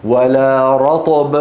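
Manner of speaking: slow tarteel with stressed رطب; slow recitation. Shown as a demonstration. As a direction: recite for the class to echo. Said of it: "قولوا معي بعدي". (تلاوة مرتلة)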